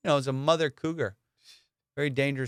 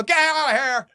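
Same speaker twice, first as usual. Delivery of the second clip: mock yell; 11 semitones higher, 5 dB louder than usual. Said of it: "Or go".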